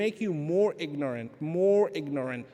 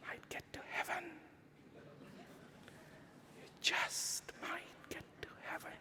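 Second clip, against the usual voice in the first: hushed, voiceless aside to the congregation; teasing whisper; 11 semitones higher, 20 dB quieter than usual. Acted. Preacher whispering a sly promise to the congregation.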